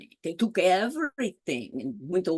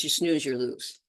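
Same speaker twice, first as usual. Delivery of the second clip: plain reading voice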